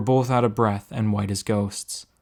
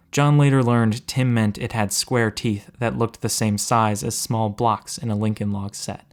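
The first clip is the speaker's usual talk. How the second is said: ordinary delivery, nothing marked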